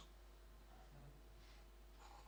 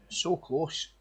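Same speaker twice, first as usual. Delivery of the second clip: big, rising sports-commentary hype; golf commentator; 3 semitones higher, 4 dB louder than usual. Acted. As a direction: sell the pressure like a broadcast. Commentary promo build-up.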